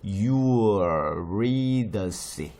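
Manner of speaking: "you will read the sea" as a drawn-out Eu-RI-dy-ce; very slow, loud syllables, stressed "read", "sea" ending; slow English pronunciation. Shown as a demonstration. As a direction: stretch "you will read the sea" into one name, Eurydice. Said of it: "C로 바뀌면서 C 발음이 났어요".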